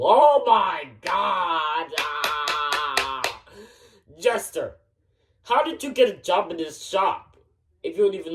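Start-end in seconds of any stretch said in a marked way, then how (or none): 0.0-3.4 long drawn-out exasperated cry; exasperated wail. Acted. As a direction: wail it slowly, stretching every vowel. Exasperated outburst at coworker's answer.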